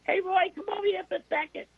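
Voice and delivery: very high boxer voice, casual; Mike Tyson. Retold or imitated. Boxer hailing the reporter after the fight, high-pitched casual impression. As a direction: call someone over in a high boxer impression. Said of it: "Tyson sees me, and he goes".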